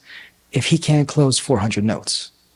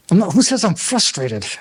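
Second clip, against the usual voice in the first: higher-pitched defensive denial; mock indignation; 5 semitones higher than usual. Person called frustrated snaps back at speaker, mock indignation. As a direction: act out an affronted retort.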